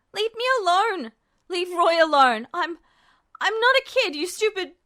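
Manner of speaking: loud, high desperate yelling; furious scream. Acted. Ed screams at Justin to leave them alone. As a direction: shout through panic.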